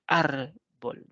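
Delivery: "Árbol" split, with a long ÁR, then bol; "Árbol" in two beats, weight on ÁR; stress on first syllable. Shown as a demonstration. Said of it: "es una palabra grave".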